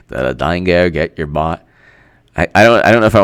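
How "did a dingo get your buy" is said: lower, rough try at Australian; amateur Australian accent; attempted Australian accent. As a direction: have a shaky go at an Australian accent. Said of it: "trying to read it with an Australian accent".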